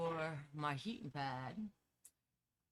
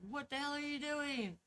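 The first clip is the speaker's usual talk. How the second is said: much higher mock yell; imitating wife's yell; 11 semitones higher than usual. Courtney yelling at her stuck partner, high mock yell. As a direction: squeak out a retold yell. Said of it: "She starts yelling at me like".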